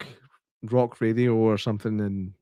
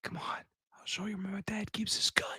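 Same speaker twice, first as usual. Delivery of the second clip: high unvoiced creepy kid whisper; creepy child whisper; 8 semitones higher, 13 dB quieter than usual. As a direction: whisper it like a spooky little boy. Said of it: "It's like".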